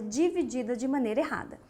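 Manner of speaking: ordinary talk, nothing marked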